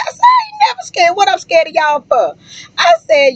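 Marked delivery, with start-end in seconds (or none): 0.2-2.4 indignant retort. Retold or imitated. Retorting to casino men.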